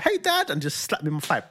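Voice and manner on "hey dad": put-on much higher, bright child greeting; cheery kid. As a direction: call out like a small boy bursting in. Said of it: "he just barged in like".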